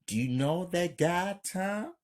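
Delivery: curious. A narrator's reading. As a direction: ask with rising, mild curiosity. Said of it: "Belinda, curious".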